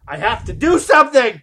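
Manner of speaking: high, loud imagined outburst; hammed-up desperate yell; mock frantic outburst. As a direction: burst out as an overwrought fan. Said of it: "my brain would go".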